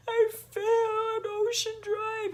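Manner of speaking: very high, slow teary wail; crying. Retold or imitated. Telling her mom about the fall, a high slow sobbing wail. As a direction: sob it out. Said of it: "You're calling me crying".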